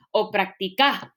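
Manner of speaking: práctica mis-stressed as prac-ti-CÁ; stress on final CA. Shown as a demonstration. Teacher offering the last option, stress on final CA. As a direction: land the weight of práctica on its last syllable. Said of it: "dónde estoy poniendo la, la mayor, ah, fuerza".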